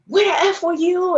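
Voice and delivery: mock yell, pitched well above normal talk; retold screaming. Partner storms into the movie theater after her, imitated screaming. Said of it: "he's screaming".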